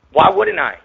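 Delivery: high, loud defiant question; indignant shout. Real, not acted. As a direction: yell it back at the doubters. Repeating rebuttal, shouted.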